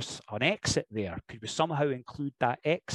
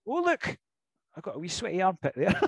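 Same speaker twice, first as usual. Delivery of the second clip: mock surprise; 6 semitones higher than usual. Acting out the ring bit.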